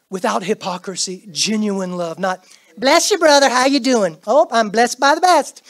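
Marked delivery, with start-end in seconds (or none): 2.8-5.6 loud, high, hammed-up hearty greeting; mock a hollow church hello; fake churchy greeting. Preacher mocking insincere greetings, loud and hammed-up. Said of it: "Not that foolish stuff".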